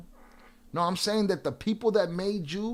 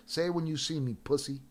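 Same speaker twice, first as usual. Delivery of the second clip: ordinary talk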